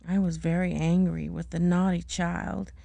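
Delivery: ordinary delivery, nothing marked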